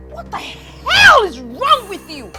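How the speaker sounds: high, loud, indignant shouting; furious outburst. Acted. Refusing to be treated as a servant, furious outburst. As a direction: hurl each question at full voice. Furious quarrel with mother-in-law.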